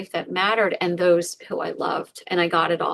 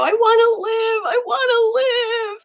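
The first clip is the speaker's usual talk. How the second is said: much higher, louder crying shout; sobbing plea; 13 semitones higher, 5 dB louder than usual. Retold or imitated. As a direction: cry out desperately to live. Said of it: "I'm sobbing and I'm crying".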